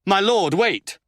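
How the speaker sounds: a lord calls after the departing duke; calling out. A narrator's reading. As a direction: call after him to stop. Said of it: "called Thierry de Kerenzou".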